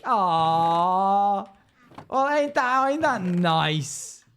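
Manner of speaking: high, slow, drawn-out "ain't that nice"; touched drawl. Real, not acted. Streamer to a kind chatter, slow touched drawl. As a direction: melt a little, stretching it out.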